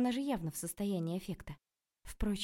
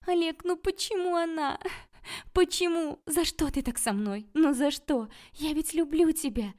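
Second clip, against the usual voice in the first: loud, high, wailing plea; crying; 10 semitones higher, 7 dB louder than usual. A narrator's reading. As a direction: wail in heartbroken desperation. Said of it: "плакала Васька".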